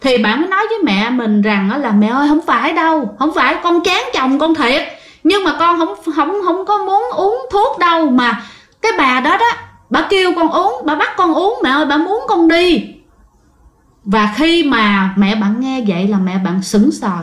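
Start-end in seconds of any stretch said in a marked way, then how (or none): 1.9-12.8 much higher, urgent protest; desperate protest. Retold or imitated. Daughter tells her mother the ghost made her drink, desperate. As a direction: insist it wasn't her choice.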